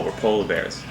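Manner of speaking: usual speaking voice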